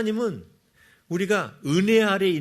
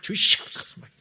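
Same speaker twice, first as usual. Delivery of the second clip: voiceless, forced pleading burst; fervent mock prayer. Believer praying as a form of effort, voiceless fervent plea. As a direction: imitate a straining, effortful prayer. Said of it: "기도도 하나의 또 노력이야".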